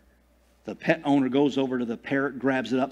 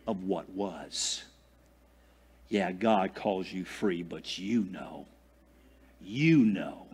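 sly devil voice; 6 semitones lower, 6 dB quieter, slower than usual. Pretend. Devil reminds you of past.

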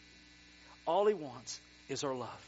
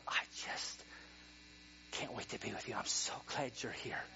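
softer and lower, hushed; tender longing; 6 semitones lower, 8 dB quieter than usual. Pretend. Pastor voicing God's longing for people, tender hush. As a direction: say it gently like a longing heart. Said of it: "the desire in the heart of".

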